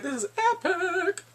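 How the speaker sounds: real giddy excitement; thrilled. Real, not acted. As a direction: gush about the scene. Thrilled by the debate.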